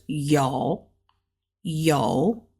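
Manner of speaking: yo dipped low and drawn out slowly; third tone yo. Shown as a demonstration. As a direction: drag yo down low in a slow third tone. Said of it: "it's a third tone".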